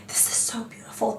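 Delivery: whispered, breathy awe over the lipstick; hushed whisper. Retold or imitated. Trying the lipstick again, she whispers in mock awe. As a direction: whisper it like a secret delight. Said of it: "you're like".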